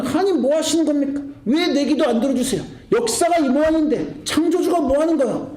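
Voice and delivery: high-pitched acted protest; indignant complaint. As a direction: voice people lightly railing at God. Acted people complaining to God.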